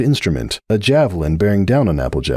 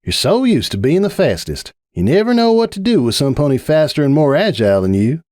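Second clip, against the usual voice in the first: raised, needling Applejack voice; taunting; 7 semitones higher than usual. A narrator's reading. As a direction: poke at his pride.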